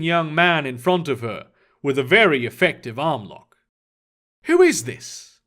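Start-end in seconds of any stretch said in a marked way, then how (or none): 4.4-5.4 sharp, high demanding question; a stern agent demanding an answer. A narrator's reading. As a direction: demand to know who he is. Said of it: "Mister Lee demanded".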